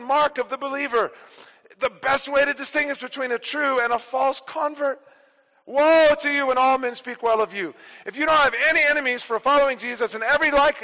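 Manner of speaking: loud, driving pulpit voice, each clause hammered; full-force preaching. Acted. Preacher rousing his congregation, loud driving preaching. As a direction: preach it out to the whole church.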